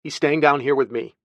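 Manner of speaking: no-nonsense parental voice; firm refusal. Acted. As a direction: tell the child no, firmly. Aunt refuses boy firmly.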